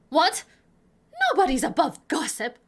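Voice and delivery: loud, high "What?", then a quick retort; old busybody snapping back loudly; indignant old lady. Acted. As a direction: brush it off as a stated fact. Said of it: "that was the right inflection".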